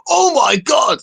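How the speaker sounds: high, loud American "Oh my God!"; American accent. Retold or imitated. Host imitating an American video reactor, American accent. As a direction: squeal it like an amazed American.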